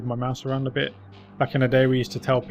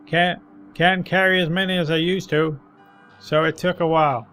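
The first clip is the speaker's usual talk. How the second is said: put-on higher, louder grizzled old man; 5 semitones higher, 5 dB louder than usual. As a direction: ramble like a tired street veteran. Old man recounts his day.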